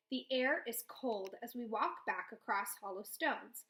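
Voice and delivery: usual speaking voice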